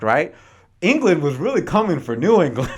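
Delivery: ordinary delivery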